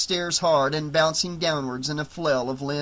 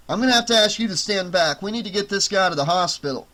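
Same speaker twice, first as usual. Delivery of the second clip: brisk medic; 4 semitones higher, 4 dB louder, faster than usual. Acted. Medic rushing the patient.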